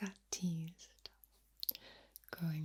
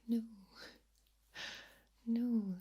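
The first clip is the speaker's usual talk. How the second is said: normal talking voice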